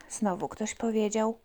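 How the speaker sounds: ordinary delivery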